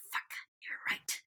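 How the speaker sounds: whispered defeat; whispered concession. Retold or imitated. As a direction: breathe the admission out under the breath. Character concedes quietly.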